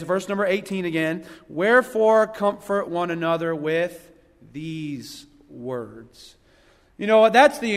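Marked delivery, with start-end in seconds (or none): 1.5-6.3 slow, dropping away to a hush on the last words; scripture reading. Acted. Preacher rereading his verse, slowing and fading at the end.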